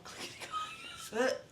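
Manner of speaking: a squealing high cackle; high shrieking laugh. Real, not acted. Recalling the clickety-clack heel, high shrieking laugh. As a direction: let out a shrill burst of laughter.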